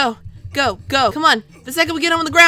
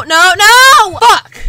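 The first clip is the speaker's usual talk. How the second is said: loud, higher outburst; real frustrated shout; 6 semitones higher, 12 dB louder, much slower than usual. Real, not acted. Gamer loses the round, frustrated shout. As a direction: explode as the round is lost.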